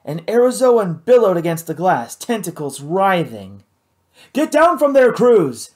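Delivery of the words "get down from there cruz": loud, high urgent shout. A narrator's reading. Hal shouts at Cruz to come down, loud urgent shout. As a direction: bark the order up at him. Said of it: "shouted Hal".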